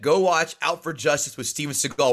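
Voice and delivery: ordinary talk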